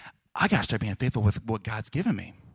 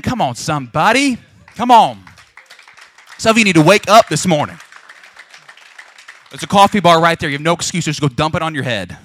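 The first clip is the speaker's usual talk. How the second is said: much louder, exhorting shout; rousing call; 4 semitones higher, 12 dB louder than usual. Real, not acted. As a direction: wake the room up. Rousing the sleepy congregation.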